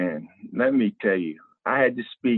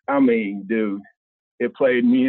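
ordinary talk, nothing marked

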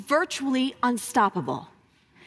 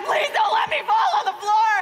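desperate plea; 8 semitones higher, 5 dB louder than usual. Retold or imitated. Desperate prayer while running.

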